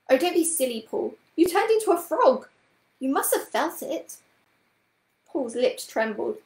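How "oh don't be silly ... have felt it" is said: high, loud, impatient scolding. A narrator's reading. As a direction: tell off a silly child impatiently. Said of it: "looked at him impatiently".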